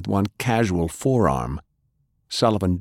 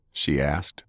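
plain reading voice

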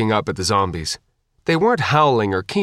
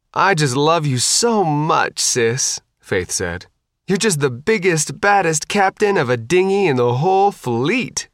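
sarcastic; 7 semitones higher than usual. Acted. Sarcastic sister teasing.

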